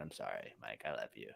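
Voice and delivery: mock tender apology. Host apologizing to cohost Mike, soft and low. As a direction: coo an apology, half joking.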